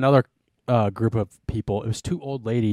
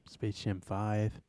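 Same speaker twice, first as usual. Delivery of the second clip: normal talking voice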